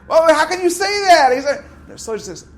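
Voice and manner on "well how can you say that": shouted out loud and high, challenging; heated objection. Retold or imitated. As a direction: burst in protesting loudly. Student jumps in arguing at shiur.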